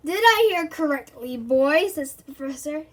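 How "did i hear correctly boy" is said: menacing. A narrator's reading. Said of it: "glaring at Terry".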